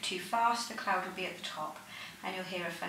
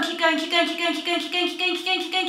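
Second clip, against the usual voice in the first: loud, much higher urgent coaching shout; lung function test shouting; 8 semitones higher, 12 dB louder than usual. Real, not acted. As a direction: push them hard to empty lungs.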